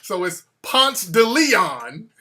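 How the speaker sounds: "Ponce de Leon" slow as "Pahnts tuh Lee-ahn"; "Ponce de Leon" as Atlanta says it, slow and loud; local pronunciation. Shown as a demonstration. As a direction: spell out "Ponce de Leon" slowly, Atlanta style. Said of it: "stop pronouncing the shit correctly".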